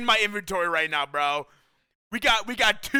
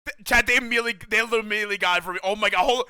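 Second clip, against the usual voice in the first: ordinary delivery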